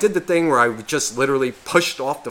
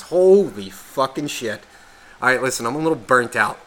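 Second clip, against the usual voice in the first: ordinary talk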